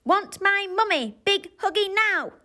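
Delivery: put-on high, loud toddler demand; demanding baby. A narrator's reading. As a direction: insist like a small child.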